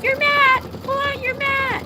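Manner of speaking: high, loud mock chorus of viewers; sing-song warning like a crowd of fans; viewers' chorus. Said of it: "on the live would be like".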